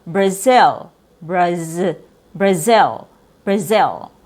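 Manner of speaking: "Brazil" as bruh-ZIL, short I; Z sound. Shown as a demonstration. Teacher correcting the mispronunciation, clear Z sound. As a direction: buzz the Z in "Brazil", keep the vowel lax. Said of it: "add a Z sound".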